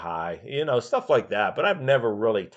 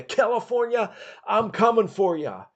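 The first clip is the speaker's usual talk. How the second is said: much higher hyped wrestler yell; mock scream; 12 semitones higher than usual. Piper shouting at the state he drives into, high mock yell. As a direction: call it out the car window, pumped up. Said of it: "he would scream out".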